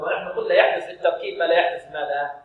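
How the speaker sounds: ordinary delivery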